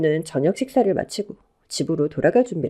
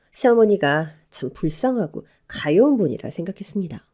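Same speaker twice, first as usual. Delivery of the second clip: usual speaking voice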